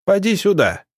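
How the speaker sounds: high-pitched summons; calling out. A narrator's reading. Village elder summoning Матвей over to him, calling out. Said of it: "окликнул вдруг староста".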